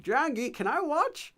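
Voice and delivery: high, tentative-sounding imitation of his earlier self; high-pitched question rising at the end; imitated beginner's request. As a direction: put on a high voice to ask politely. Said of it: "something like that".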